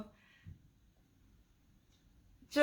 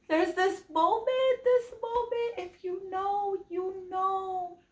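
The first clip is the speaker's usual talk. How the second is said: overcome with feeling; 10 semitones higher than usual. Real, not acted. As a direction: squeeze it out high, as if too moved to explain. Overcome by a moving book moment.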